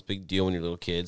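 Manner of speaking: ordinary talk, nothing marked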